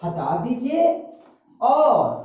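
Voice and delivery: stretched syllables, long pauses between phrases; very slow, phrase-by-phrase dictation. Real, not acted. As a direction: dictate for students writing it down. Teacher dictating the rule slowly.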